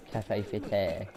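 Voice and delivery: Cafe Theatre with French-style vowels; French accent. Shown as a demonstration. Gamer showing the accented name of the venue, French accent. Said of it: "like, the accent".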